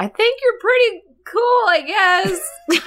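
high, put-on grudging kid voice; lightly voiced sulky teen. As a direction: admit it reluctantly. Teen son answering dad.

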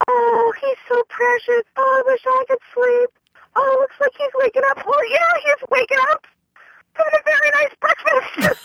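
high, sugary imitation of a nosy lady; mock cooing stranger. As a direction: mimic the cooing woman, high and syrupy. Mimics the stranger cooing at baby.

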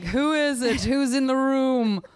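languid, high, slow swooning lady; femme fatale. Pretend. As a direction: drawl it like a drugged Victorian invalid. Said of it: "I could just be like".

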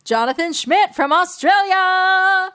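high, loud, drawn-out donor shout-out; hammed-up announcer. Thanking a donor on the show, big announcer call. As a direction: stretch the name out like a big arena introduction.

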